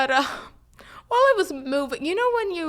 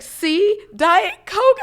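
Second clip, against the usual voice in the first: higher, louder, pointed; smug proof; 8 semitones higher, 10 dB louder, much slower than usual. Retold or imitated. Worker handing back the soda, mock smug and loud. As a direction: show her she's wrong, smugly. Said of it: "and she's like".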